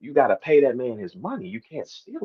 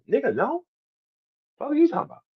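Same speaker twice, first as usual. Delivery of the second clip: incredulous; 12 semitones higher, 4 dB quieter, faster than usual. Retold or imitated. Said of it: "conversation would be on Twitter".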